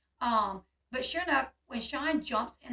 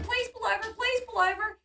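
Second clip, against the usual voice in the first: very high, anxious begging; retold plea, urgent and pitched right up; insistent pleading; 10 semitones higher than usual. Woman begging Sean as cop follows, insistent pleading.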